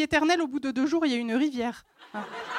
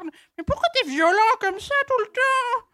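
high, slow whiny complaint; whiny ovary voice; 8 semitones higher than usual. Acted. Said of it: "j'ai fait parler mes ovaires".

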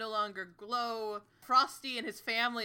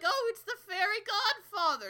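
cheerful relief; 9 semitones higher than usual. Retold or imitated. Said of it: "they're like".

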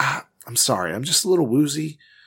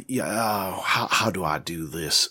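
usual speaking voice

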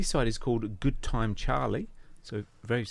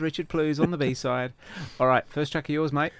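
normal talking voice

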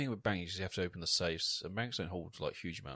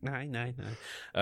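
higher-pitched German try-out; attempted German accent; 5 semitones higher than usual. Searching for the girl's voice, attempted German accent. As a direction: test out a few German syllables. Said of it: "how do you do a German accent".

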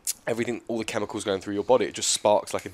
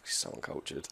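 breathy whispered jab; whispered tease; 6 semitones lower, 14 dB quieter than usual. Acted. Whispering a mock insult to the co-host. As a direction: slip in a whispered dig.

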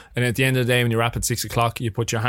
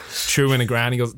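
ordinary talk

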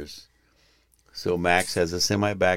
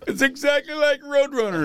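laughing exclaim. Real, not acted. To his wife while the boy crashes, high laughing cry. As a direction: shriek it out through laughter. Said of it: "I'm like".

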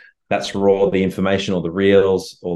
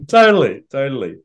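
high, loud gushing yes; excited; 8 semitones higher, 7 dB louder than usual. Real, not acted. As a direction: blurt it out eagerly. Enthusiastic agreement.

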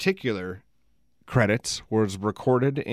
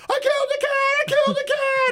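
goofy panic. Retold or imitated. Panicked teen runs upstairs naked.

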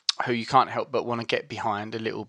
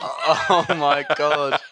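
long drawn-out gasp through laughter; helpless, slow laughing exclamation; cracking up, barely getting it out; 4 dB louder, much slower than usual. Real, not acted. Laughing at nearly saying it.

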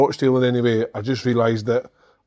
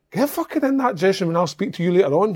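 higher-pitched mock scolding; retold telling-off; 7 semitones higher than usual. Coach sending laughing youngster to dressing room, higher-pitched scolding. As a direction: order him away as an angry coach.

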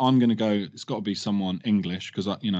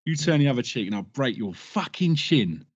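lightly put-on London geezer accent, menacing; a hint of a cockney gangster threat; Bricktop from Snatch. Quoting a rap line to mates, in a light cockney geezer accent. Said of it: "Bricktop from Snatch".

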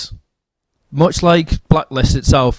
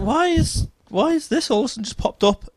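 incredulous; 12 semitones higher, 6 dB quieter than usual. Retold or imitated. Retold disbelief at the article.

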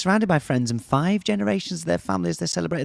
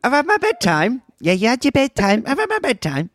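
higher and louder, a hammed-up old granny; mock old lady; 10 semitones higher, 4 dB louder than usual. To her co-host, pretending to be a hundred-year-old lady, higher and louder. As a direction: play a toothless old lady reporting her day. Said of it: "Put your teeth back in".

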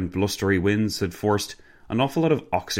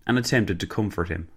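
ordinary delivery

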